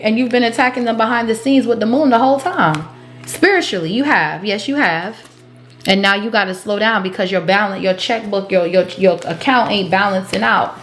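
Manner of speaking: ordinary talk, nothing marked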